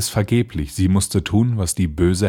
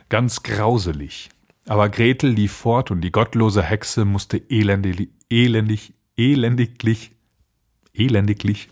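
usual speaking voice

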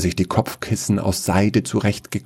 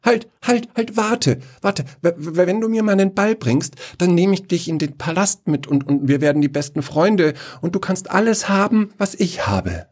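higher, stammering plea; desperate begging; 10 semitones higher than usual. Acted. Prince begs the frog to fetch his ball, high and stammering. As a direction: call out and stumble to promise anything.

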